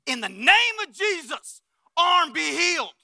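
loud, high and slow command; commanding healing prayer. Pretend. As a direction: proclaim it like a bold faith healer.

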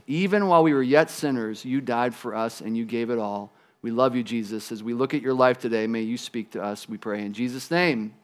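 low, soft, reverent prayer. Real, not acted. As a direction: pray quietly, sinking toward the close. Closing the prayer.

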